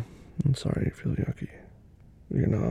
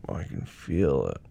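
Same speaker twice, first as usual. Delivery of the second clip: normal talking voice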